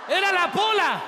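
high, loud exclamation; full-voiced comic horror; shocked shout. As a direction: cry out at the big reveal. Listener shouts shocked reaction.

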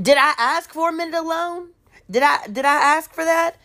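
high, incredulous question; indignant objection. Retold or imitated. Tiffany objecting to time alone, indignant. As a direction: push it up high in mock disbelief. Retold objection to being left.

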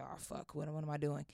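whispered inner panic; whispering alarm to herself in a room of strangers; panicked whisper. Retold or imitated. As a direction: hiss it under your breath, scared. Panicked thought in strange apartment.

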